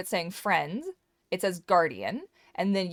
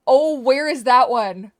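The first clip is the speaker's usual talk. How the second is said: gasp, then a loud slow question; suddenly spot something, gasp; surprised gasp; 6 semitones higher, 12 dB louder, slower than usual. Real, not acted.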